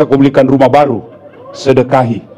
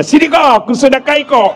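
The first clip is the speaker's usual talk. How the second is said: mock husband reply; 8 semitones higher than usual.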